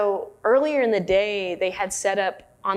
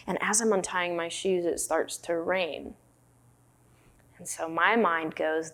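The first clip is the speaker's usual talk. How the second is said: normal talking voice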